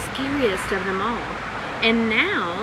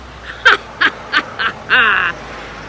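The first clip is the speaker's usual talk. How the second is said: loud booming villain laugh; terrible giant laugh; 5 dB louder than usual. Acted. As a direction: let a giant's laugh echo round the valley. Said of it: "laughing a terrible, terrible laugh".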